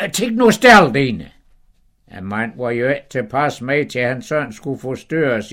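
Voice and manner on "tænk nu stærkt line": high, loud scolding wife; Sara's high voice. Acted. Wife scolding her dozing husband, high and loud. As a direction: nag him in a high, sharp wife's voice. Sara calling out.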